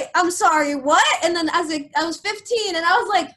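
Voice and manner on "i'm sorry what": louder, higher, a stunned I'm sorry what; stunned disbelief. Retold or imitated. Teen hearing her grandmother's claim, stunned disbelief. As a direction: recoil in disbelief at what she just heard.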